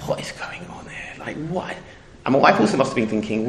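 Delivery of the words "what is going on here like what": hushed, breathy whisper of disbelief; whispered thought. Retold or imitated. Bewildered whisper to himself about the perfect couple. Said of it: "always thinking to myself".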